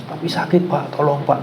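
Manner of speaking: high, pleading cry of pain. Retold or imitated. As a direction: beg him to stop, hurting. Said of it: "Oh, makin teriak". (teriak kesakitan memohon)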